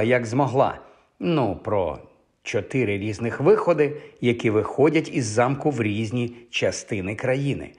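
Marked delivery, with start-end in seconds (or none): none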